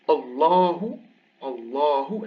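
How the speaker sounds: Allahu drawn out with a dark L and backed "aa"; emphatic lam. Shown as a demonstration. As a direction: say Allahu with a thick, heavy L. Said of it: "emphatic A".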